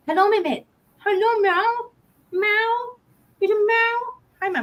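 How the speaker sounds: very high voice for greeting a cat; high, playful cat-greeting voice; pet talk. Real, not acted. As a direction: coo high at the kitty.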